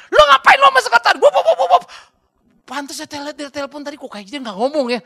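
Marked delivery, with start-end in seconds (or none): 0.1-1.8 high, fast shouted scolding; loud comic tirade turning into gibberish; mock furious wife. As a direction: imitate a raging wife, ending in babble. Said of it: "ngamuk istrinya, dia dimarahin".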